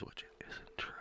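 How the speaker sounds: breathy whispered jab; whispered aside. Real, not acted. Quietly undercutting the coworker's claim, whispered aside.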